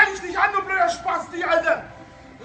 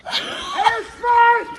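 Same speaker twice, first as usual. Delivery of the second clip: loud, high yelled curse; shouted insult; 5 semitones higher, 6 dB louder than usual. Real, not acted. Homeowner cursing the kids outside, loud shout. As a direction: spit the insult out at full volume.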